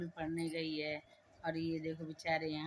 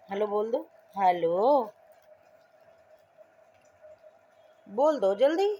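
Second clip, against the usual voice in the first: loud urging; 4 semitones higher, 14 dB louder than usual. Real, not acted. Mother loudly presses her child to say hello quickly.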